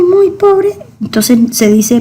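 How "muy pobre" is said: "muy" jumps high; stress on muy. Shown as a demonstration. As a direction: lift "muy" way up to show how poor. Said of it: "para decir es que es muy".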